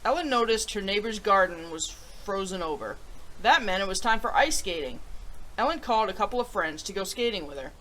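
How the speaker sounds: put-on reading a picture book to children; storybook read-aloud voice; story narration. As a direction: tell it gently to young listeners.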